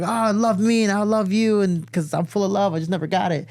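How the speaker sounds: gushing brother. Retold or imitated. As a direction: ham up his brother's over-the-top affection. Imitating affectionate brother.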